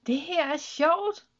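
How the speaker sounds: high, slow delighted exclamation; joyful call, stretching the last word; relieved shout. A narrator's reading. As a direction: cry out with relieved glee. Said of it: "råbte hun lettet".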